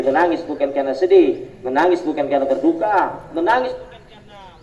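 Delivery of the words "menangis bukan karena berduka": normal talking voice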